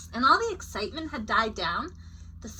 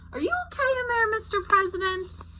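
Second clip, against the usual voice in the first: high, caring call-out; 11 semitones higher than usual. A narrator's reading. Checking on a character.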